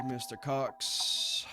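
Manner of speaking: drawn-out slow "Mister Cox"; slow menacing. Acted. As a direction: stretch "Mister Cox" out slowly.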